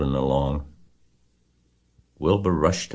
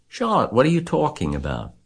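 high-pitched, incredulous question; alarmed cry; 10 semitones higher than usual. A narrator's reading. Wilbur questions Charlotte about not going home, alarmed cry. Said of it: "he cried".

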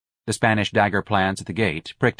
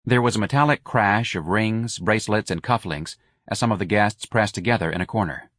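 plain reading voice

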